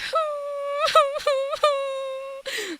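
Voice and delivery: very high, loud whimpering wail; imitated weird crying. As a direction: wail like a puppy being kicked. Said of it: "the way she cries is just so weird".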